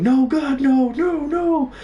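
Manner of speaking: high, drawn-out mock screams; hammed-up high-pitched screaming; imitated screaming. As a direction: wail it like panicking teammates, very high pitch. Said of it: "I hear them screaming".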